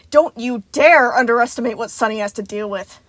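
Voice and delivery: loud, indignant outburst; fierce, offended defense of a friend; exploding hiss. A narrator's reading. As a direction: explode at them after a long silence. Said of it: "Moon hissed, absolutely exploding with noise".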